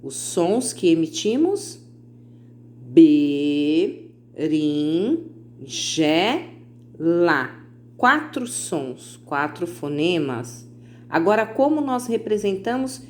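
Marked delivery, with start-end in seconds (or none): 2.8-7.5 berinjela dragged out very slowly, sound by sound. Shown as a demonstration. Teacher counting the four sounds, berinjela drawn out. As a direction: stretch berinjela out so each sound can be counted. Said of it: "Vamos contar os fonemas".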